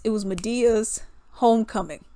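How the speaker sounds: normal talking voice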